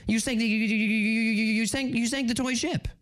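rapid cartoon stutter on the first word; stammering cartoon pig impression; Porky Pig. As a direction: get stuck repeating, then push the phrase out. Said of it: "Please say Porky Pig".

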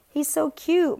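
high-pitched girlish squeal, lightly acted; delighted squeal. A narrator's reading. Girl fussing over little David, delighted squeal. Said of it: "Mariska squealed".

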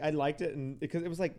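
ordinary talk

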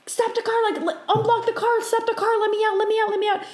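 high-pitched retold panic; freaking out. Passenger begging the driver to let her out, panicked. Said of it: "freaking out thing".

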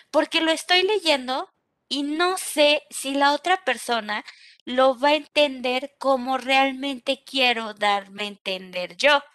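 usual speaking voice

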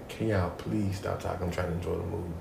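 quiet firm repeat. Retold or imitated. Repeating request.

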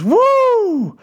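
high, held celebratory "woo"; imitated whoop. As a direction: let out a high whoop, mimicking his joy. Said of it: "And then he goes".

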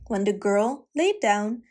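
usual speaking voice

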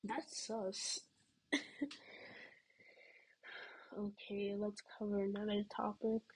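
hushed, very soft whisper. Real, not acted. Vlogger awkwardly changes the topic, whispering. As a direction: drop to a sheepish whisper and move on.

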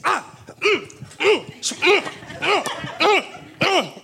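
high strained hums; mock struggling groans. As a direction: imitate someone fighting it. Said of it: "she started to fight".

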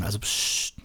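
tonloses Psst; flüstern. Acted. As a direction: leise zischend zum Schweigen mahnen. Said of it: "müssen flüstern jetzt". (geflüstert)

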